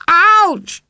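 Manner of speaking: high, loud yelp of hurt; pained cry. Acted. Struck boy yelps at the stone, high and loud. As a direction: yelp like the stone hit you.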